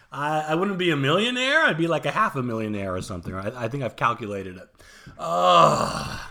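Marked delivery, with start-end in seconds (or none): 5.2-6.2 loud, drawn-out groan of regret; regretful groan. Real, not acted. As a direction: groan over a lost fortune. Regret over missed Bitcoin fortune.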